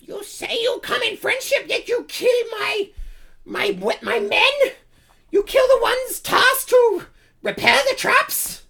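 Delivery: very high-pitched accusing kobold voice; Kakarol. Acted. Kobold leader accusing adventurers of killing his men, high creature voice. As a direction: challenge them indignantly as a squeaky little dragon lord.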